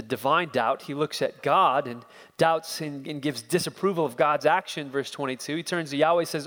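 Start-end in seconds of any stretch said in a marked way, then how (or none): none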